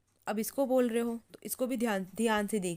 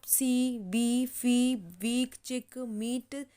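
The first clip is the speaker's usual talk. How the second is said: very slow, each word drawn out; teacher reviewing the words slowly; slow word list; much slower than usual. Shown as a demonstration. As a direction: read चिक, मीट, सीन and सी slowly. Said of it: "तो sound भी देख सकते हैं आप".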